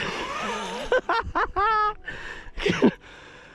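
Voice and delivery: high, breathless laugh of relief; elated laugh. Real, not acted. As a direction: let the joy spill out in a long laugh. After landing the fish.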